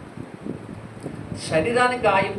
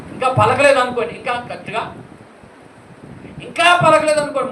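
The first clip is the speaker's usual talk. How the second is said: urgent emphasis; 6 semitones higher, 8 dB louder than usual. Acted. Rising urgency to congregation.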